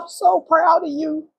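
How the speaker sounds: high, tear-choked voice; crying. Real, not acted. Quoting her late father's pride through tears. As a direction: overcome while quoting her dad.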